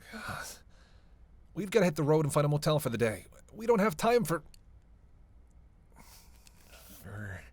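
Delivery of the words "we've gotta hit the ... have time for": fast, loud, raised protest; urgent exasperation. Acted. Urging partner to hit the road, urgent exasperation. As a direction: rush the argument out, climbing higher.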